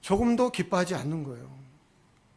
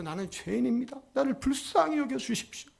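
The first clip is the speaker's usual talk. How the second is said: higher-pitched acted plea for mercy; humble pleading; 7 semitones higher than usual. Tax collector praying in a corner for mercy, pleading. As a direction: imitate a penitent's prayer, pitched up.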